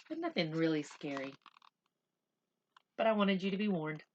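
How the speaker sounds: plain reading voice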